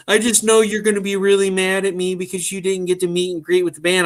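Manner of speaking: high, whiny mock upset; mock-tearful rush of worry; imitating tearful wife. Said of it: "bawling in tears".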